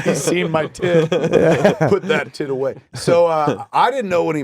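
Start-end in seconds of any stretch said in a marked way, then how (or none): 0.0-2.8 slow, higher, through laughter; retold line cracking up as you say it; laughing retelling. Comic telling his own hospital story, laughing retelling.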